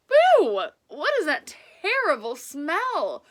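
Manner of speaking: loud, high shocked outcry at the smell; a big disgusted yelp from the old lady; disgusted cry. Acted. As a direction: recoil and cry out. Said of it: "she cried".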